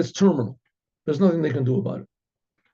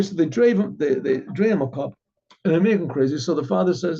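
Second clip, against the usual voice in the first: ordinary delivery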